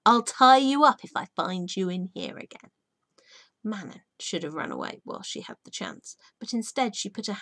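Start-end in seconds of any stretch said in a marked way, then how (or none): 0.1-2.7 angry threat. A narrator's reading. Furious woman threatens girl.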